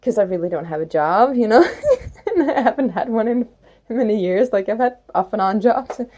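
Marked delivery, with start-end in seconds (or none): 1.7-2.2 quick high amused laugh; a burst of laughter at herself; short laugh. Real, not acted. Laughs about having no job.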